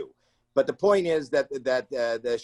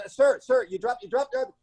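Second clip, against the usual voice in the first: calling out; 10 semitones higher than usual. Retold or imitated. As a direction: call after a man running out the door. Calling after fleeing guest.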